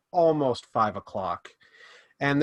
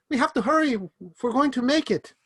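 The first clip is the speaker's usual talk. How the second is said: much higher urgent whisper-free call; urgent Lily; 11 semitones higher than usual. Acted. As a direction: hurry them along as Lily. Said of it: "And she says".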